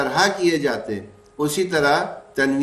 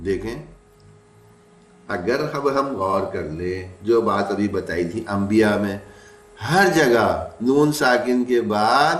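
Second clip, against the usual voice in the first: ordinary talk, nothing marked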